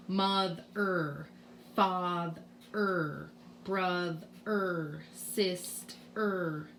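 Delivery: "Mother, father, brother, sister" with each ending sounded out as a separate "er"; ER sound demonstrated. Shown as a demonstration. Teacher shows bossy R words, sounding "er" apart in "Mother, father, brother, sister". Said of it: "it says the er sound".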